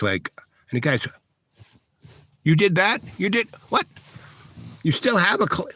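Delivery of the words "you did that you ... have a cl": much higher stunned disbelief; incredulous. Retold or imitated. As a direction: gape at what just happened. Guard stunned by the trick.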